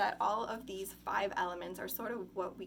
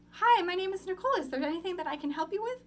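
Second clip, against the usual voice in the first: high, loud, fast customer-service greeting; cashier voice; 10 semitones higher, 9 dB louder, faster than usual. Shown as a demonstration. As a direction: pitch it way up like a cashier offering help. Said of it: "I used to call this my cashier voice".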